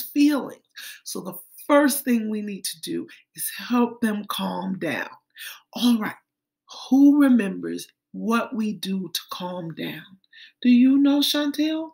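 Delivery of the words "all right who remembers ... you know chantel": ordinary delivery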